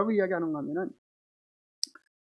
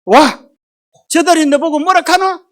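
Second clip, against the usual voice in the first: loud, higher, blunt Gyeongsang dialect retort; gruff Gyeongsang man; 6 semitones higher, 9 dB louder, slower than usual. Shown as a demonstration. Preacher mocking a Gyeongsang husband's blunt reply to his wife. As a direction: bark it back like a rough Gyeongsang husband. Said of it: "경상도 남자 뭐라 그런다는 거예요?".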